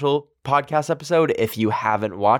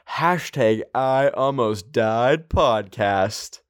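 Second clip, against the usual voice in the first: slow clear hashtag; much slower than usual. Shown as a demonstration. Spells out the hashtag slowly.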